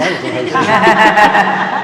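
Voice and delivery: laughing. Real, not acted. Joking about own youth.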